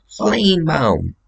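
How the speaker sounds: slow, high, drawn-out name; slow high name reveal; announcing a name. Retold or imitated. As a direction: stretch it out up high like a grand reveal. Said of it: "what do you want your name to be".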